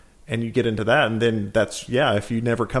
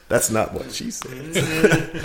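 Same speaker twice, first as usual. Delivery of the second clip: laughing; 3 semitones higher, 4 dB quieter, much slower than usual. Real, not acted. Joke amid laughter.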